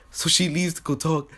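ordinary talk, nothing marked

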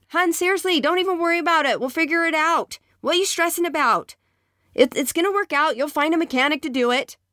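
high-pitched dismissive husband voice; voiced husband. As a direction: brush off her worry, light and breezy. Husband voiced soothing wife.

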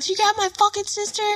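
high, strained retold protest; brother's angry reply. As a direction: imitate a brother justifying the attack.

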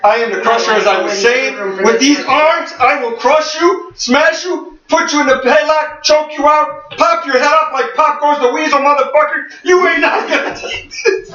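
high, hammed-up wrestler brag; barbarian. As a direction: boast like a barbarian champion. Wrestler brag, arms boast.

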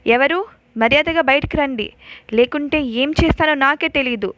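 calls out into the dark to scare them; threatening shout. Acted. Sheshayya shouts at an unseen stalker in the bungalow, threatening.

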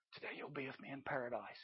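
very soft, low, quick echo; barely heard, like a memory; faint remembered voice. Acted. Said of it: "you can almost hear that voice".

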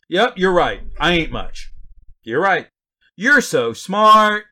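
loud, high mock agreement; sarcastic. Preacher paraphrasing Paul to the know-it-alls, sarcastically. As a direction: concede it with exaggerated, drawn-out false admiration. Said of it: "That's essentially what he's saying there".

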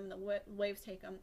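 ordinary talk, nothing marked

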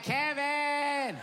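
high drawn-out call for help; wife in the water calling to her husband. Retold or imitated. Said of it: "She's like".

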